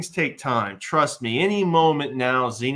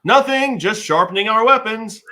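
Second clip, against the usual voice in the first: high, loud, too-innocent squeak; nervous cover-up; 9 semitones higher, 9 dB louder than usual. Acted. Joxer caught by Xena, squeaks a high guilty excuse. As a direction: blurt it out guiltily, pitched up high.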